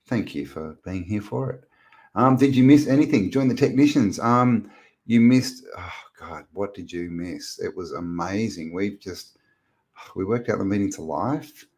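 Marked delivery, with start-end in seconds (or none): none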